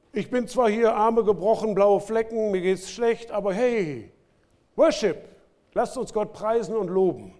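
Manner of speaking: lightly acted cheerful prophet; bruised but cheering, a hint of exuberance; upbeat praise. Jeremiah urging praise despite his wounds, mildly upbeat.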